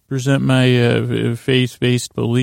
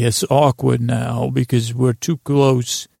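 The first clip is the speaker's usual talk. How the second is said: usual speaking voice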